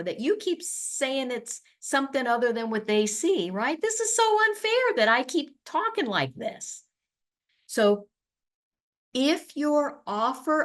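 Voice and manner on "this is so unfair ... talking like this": mock whiny complaint.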